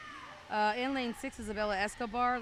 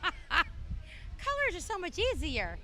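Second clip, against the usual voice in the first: high, slow line through a laugh; giggling punchline; 10 semitones higher, 3 dB louder, slower than usual. Real, not acted. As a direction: laugh it out at your own joke. Laughing at own joke.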